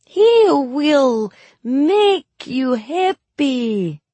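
high, loud, very slow fake Suli accent; awful Suli accent. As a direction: ham up a dreadful Suli accent. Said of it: "My accent was even worse".

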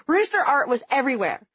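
ordinary delivery, nothing marked

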